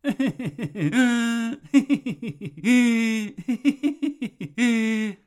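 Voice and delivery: braying hee-haw laugh; long braying donkey laugh. Shown as a demonstration. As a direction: bray like a donkey. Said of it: "Then there's the donkey laugh".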